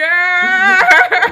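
a loud, high laugh that rings like a song; high singing laugh. Real, not acted. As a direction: let the laugh burst out high and bright. Bursts out over the story.

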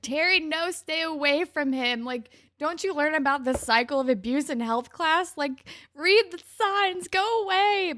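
high, loud pleading, building to the end; exasperated warning. Retold or imitated. Viewer warning Terry away from her abuser, exasperated. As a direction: urgently beg her to get away. Speaker acts pleading with character.

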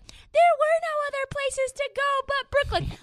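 very high, put-on mocking voice; mocking doubters. Host mimics those dismissing the scoop, high mocking voice. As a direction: squeak out the excuse in mockery. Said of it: "it's like".

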